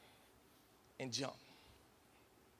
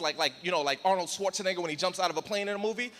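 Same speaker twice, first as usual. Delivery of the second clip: normal talking voice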